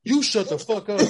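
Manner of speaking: high, loud retort; hammed-up angry moviegoer shouting back; mock defiant comeback. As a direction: fire it back at the shusher. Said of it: "one person that ain't going for that".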